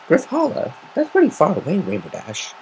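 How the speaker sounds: high, worried surprise; worried Twilight. Acted. Twilight worried to Rainbow Dash about distance. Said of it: "she said, worried".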